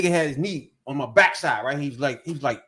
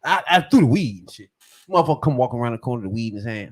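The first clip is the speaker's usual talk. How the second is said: ordinary talk, nothing marked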